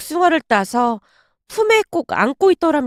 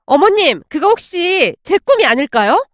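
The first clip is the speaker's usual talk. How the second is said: eager, higher-pitched pipe-up; excited exclamation; 3 semitones higher than usual. Acted. Daughter-in-law claiming the dream from mother-in-law, excited. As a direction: jump in with excitement. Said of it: "새언니가 신나서 외쳤어요".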